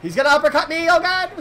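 high, loud panicked shout; panicked yell. Real, not acted. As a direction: yell as the hit comes. Killer about to hit him.

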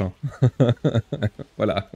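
ordinary talk, nothing marked

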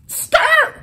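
a very high screech like brakes; imitated skid. Police pull up on his car, mimics the screech. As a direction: squeal it like a car stopping hard.

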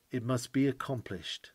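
ordinary delivery

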